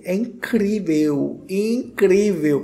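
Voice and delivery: slow, drawn-out incrível; emphatic praise. Real, not acted. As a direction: lean hard on incrível. (incrível dito devagar e enfático)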